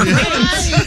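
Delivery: a high, real burst of laughter. Real, not acted. Laughing as he tells friends about the mixup. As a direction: crack up at your own story.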